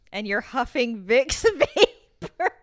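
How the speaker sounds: laughing. Real, not acted. Laughing at friend.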